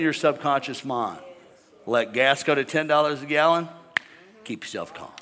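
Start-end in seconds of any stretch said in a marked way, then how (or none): none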